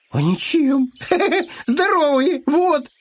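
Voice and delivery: high, giggly boast; chuckling hare. Acted. Hare brags of his health to bear, high and giggly. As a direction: boast with a squeaky little laugh.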